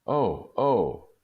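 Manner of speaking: a wife thrilled by a gift, exclaiming; joyful cry. Acted. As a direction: cry out with sudden joy. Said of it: "she jumped up and cried".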